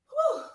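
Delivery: high breathy exhale of effort; winded whew. Real, not acted. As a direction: blow out a tired whew. Coach winded mid-workout.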